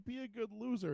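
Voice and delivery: high-pitched imitated student; coaxing kid. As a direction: gently urge the teacher, re-enacting it. Nice kid pleading with teacher.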